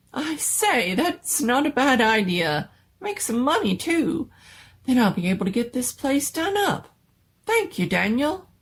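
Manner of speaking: cheered by a sudden idea; the doctor thanks his servant, delighted. Acted.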